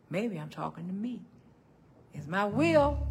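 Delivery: ordinary delivery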